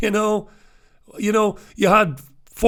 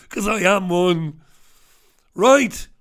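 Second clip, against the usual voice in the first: normal talking voice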